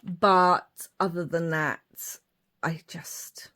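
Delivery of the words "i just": a voiceless, much softer trail-off; whispered aside. Real, not acted. Talking about leather boots, trailing off into a whisper. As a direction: drop to a whisper.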